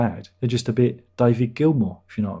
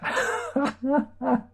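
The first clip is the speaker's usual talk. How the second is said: laughs; 13 semitones higher than usual. Real, not acted. Laughing at daughter's joke.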